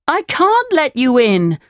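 high puppet voice calling to someone outside; high-pitched, distressed puppet call. A narrator's reading. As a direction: call back in a high little voice. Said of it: "Pinocchio called to Geppetto".

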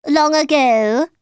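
high, loud Mad Hatter voice; Mad Hatter storytelling. A narrator's reading. Mad Hatter starting his tale for Alice, theatrically.

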